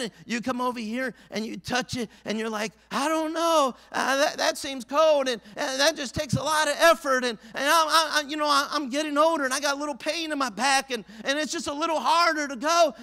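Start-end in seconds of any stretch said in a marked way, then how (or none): 2.9-12.9 higher, louder hesitant excuses; reluctant swimmer. Retold or imitated. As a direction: stall at the edge of the cold water. Voicing a reluctant, aging swimmer.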